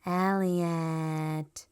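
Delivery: drawn-out alien croak of the name. A narrator's reading. E T calling his friend by name, slow alien voice. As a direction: stretch the name slowly like E T. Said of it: "E T said".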